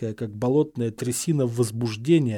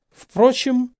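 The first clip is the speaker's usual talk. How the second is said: high, loud, angry ring; sharp, raised, furious voice; ringing with anger; 11 semitones higher, 6 dB louder than usual. A narrator's reading. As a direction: let anger make the word ring out. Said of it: "звенящим от злости голосом произнёс".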